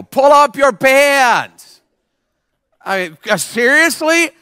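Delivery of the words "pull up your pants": calling out. Pretend. Preacher yells from a car window at saggy pants, calling out. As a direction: holler it slowly out the window.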